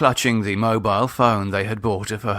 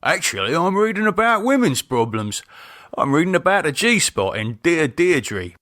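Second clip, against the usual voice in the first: higher, mock indignant husband; hammed-up innocent; feigned offence; 7 semitones higher than usual. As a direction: pretend to be offended. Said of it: "feigning offence".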